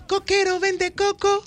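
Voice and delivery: sexy. Shown as a demonstration. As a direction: sing it sexy to her.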